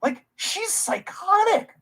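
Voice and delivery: slow high scornful verdict; pointed slow verdict. Real, not acted. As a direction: lay it out slowly and incredulously.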